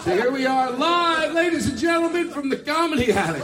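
high emcee welcome; announcer voice. Real, not acted. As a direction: announce the live show. Live show opening.

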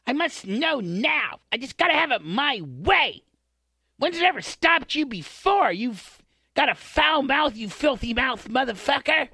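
high, slow whiny brat; spoiled princess voice. Retold or imitated. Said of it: "She goes up about three octaves".

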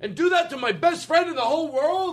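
very high, wounded protest; mock offended. Host insisting he'd never deceive his friend, mock offended. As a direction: shoot up high in hammed-up indignation. Indignant denial to accusing friend.

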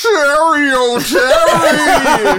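high, loud, slow booming announcer; Don Pardo announcer voice. Pretend. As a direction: shout it out like a game show announcer. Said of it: "Don Pardo style".

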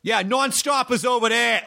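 high, rising Boston nonstop with a nasal o; caricatured Boston question; Boston accent. Retold or imitated. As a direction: ask it like a Boston driver. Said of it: "the most caricaturized Boston accent".

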